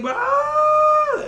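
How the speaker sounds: very high, loud scream; sudden wild cry of a ninja losing it; frenzied scream. Retold or imitated. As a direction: let out a crazed shriek. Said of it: "totally flips out".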